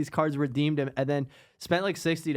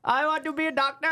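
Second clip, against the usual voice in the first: put-on Vine kid quote; 10 semitones higher than usual. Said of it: "those videos were so funny".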